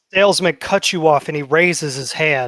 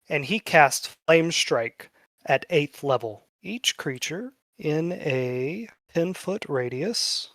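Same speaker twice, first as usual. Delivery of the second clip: normal talking voice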